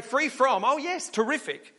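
high, bright sarcastic cheer; mock cheering at being free; mock delight. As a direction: sell the fake excitement. Mock enthusiastic reply.

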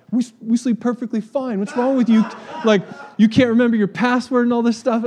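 high, loud scoffing scammer voice with a laugh; mocking scammer. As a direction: sneer back at him. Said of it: "they were like".

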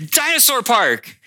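put-on high, slow, drawn-out child's cry; excited little girl. Daughter answering her dad, hands in the air, thrilled. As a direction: belt it out like a thrilled toddler. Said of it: "puts both hands in the air and just goes".

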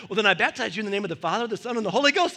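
fast, loud, rising hammed-up blessing; rushed mock baptism. Avery baptizing the younger kid, rushed and loud. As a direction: rattle off the blessing and dunk him. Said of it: "Avery went".

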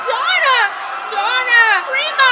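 yelling her name; shouting. Acted. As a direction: shout it across the ship.

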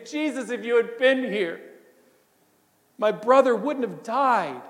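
a touch of tearful, broken pleading; weeping plea. Acted. Mary, grieving at Jesus' feet, pleads through mild tears. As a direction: let it crack a little as if crying at his feet. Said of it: "fall at Jesus' feet, weeping and wailing".

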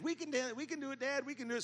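put-on high child voices, eagerly pleading; a hint of small eager children; excited kids. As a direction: imitate little kids begging dad.

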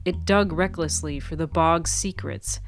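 ordinary delivery

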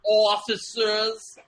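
put-on high, very slow hammy Italian-American accent; Doug Hutchinson's Italian-American accent. Showing how he greets the cops, drawn-out Italian-American accent. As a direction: stretch the word out in exaggerated Italian-American. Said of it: "That's how he says it".

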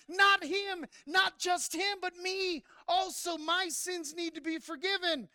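loud high shout, easing down; crying out. Pretend. Pastor voicing the room crying out, loud plea. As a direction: cry out for your own forgiveness. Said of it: "should have been crying out".

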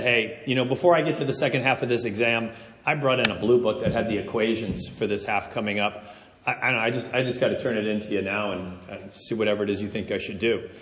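low, soft retold confession; sheepish confession. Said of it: "talked to the professor and said".

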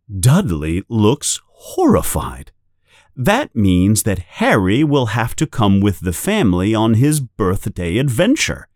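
ordinary delivery, nothing marked

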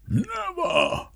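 very high, strained, inhuman cry of refusal; villain's shriek. Acted. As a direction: shriek it out in defiance.

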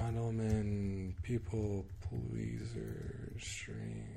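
very slow, very soft drawn-out words; absent-minded search muttering. Real, not acted. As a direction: mutter it slowly to yourself while searching. Mumbling a search being typed.

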